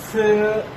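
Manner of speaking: "स" pushed out with a puff of air, like th; breathy s demo. Shown as a demonstration. Teacher models "स" with air escaping for students. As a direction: let air escape as you say "स". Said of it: "आपके मुंह से हवा खारिज हो".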